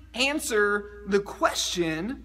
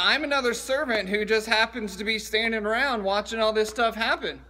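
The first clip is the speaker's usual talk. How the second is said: ordinary talk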